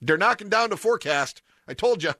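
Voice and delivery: high-pitched, put-on regional accent; Pops's accent. Host rereads Pops's text after explaining, put-on accent. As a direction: imitate an older relative's folksy accent. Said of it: "I always read his texts in an accent".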